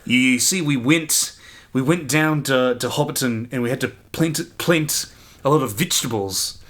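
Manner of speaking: put-on New Zealand accent, conversational commentary; Peter Jackson. Imitating a film commentary for co-host, New Zealand accent. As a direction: chat in a New Zealand accent with shifted vowels. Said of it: "I can hear Peter Jackson's voice in my brain".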